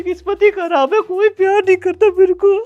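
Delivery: fake crying. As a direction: blubber like a sulking little brother. Son complains nobody loves him. (रोते हुए, बनावटी सिसकियों के साथ शिकायत)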